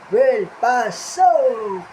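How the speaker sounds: loud, drawn-out showman's call; announcer call. Acted. Host calling Will onstage, loud and drawn-out. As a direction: call the puppet onstage, stretching the name.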